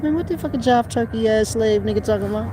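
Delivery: fast, high mocking quote; mock New Yorker. Retelling a friend's baffled reaction, fast and high. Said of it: "So he said".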